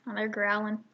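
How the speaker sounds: usual speaking voice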